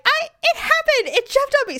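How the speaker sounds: high-pitched, flustered protest; imitated wailing self-defence; frantic insistence. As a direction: stammer it out, desperate to be believed. Protesting she is not lying.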